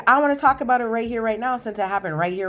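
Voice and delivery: quick mock-angry insistence; retold insistent demand. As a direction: push back fast, imitating an upset husband. Husband insists on arguing in store.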